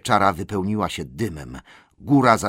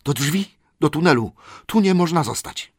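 urgent hobbit voice; 7 semitones higher, 3 dB louder than usual. A narrator's reading. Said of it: "szepnął hobbit bez tchu".

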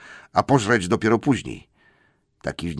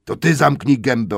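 higher, louder troll retort; angry retort; 6 semitones higher, 6 dB louder than usual. Acted. Tom angrily retorts to William. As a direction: fling it straight back at him. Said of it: "odparł Tom".

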